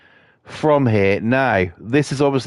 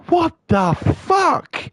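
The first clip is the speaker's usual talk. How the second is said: high, drawn-out incredulity; exasperated high mock reaction; acted disbelief; much slower than usual. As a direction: stretch each word high in mock outrage. Acted reaction to the shouting woman.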